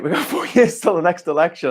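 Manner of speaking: despairing laugh through the words. Real, not acted. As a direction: laugh helplessly at bad news. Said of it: "I don't know why I'm laughing".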